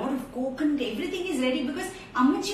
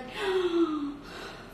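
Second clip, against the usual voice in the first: long, high intake of breath; shocked gasp; 5 semitones higher than usual. Acted. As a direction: gasp in mock horror. Storyteller reacts to tight clothes.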